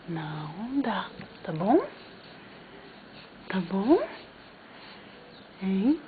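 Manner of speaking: calm the puppy down gently; soft low comforting voice; soft soothing. Real, not acted. Soothing the pet softly.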